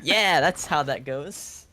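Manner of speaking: ring announcer intro. Pretend. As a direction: hype up the player like a ring announcer.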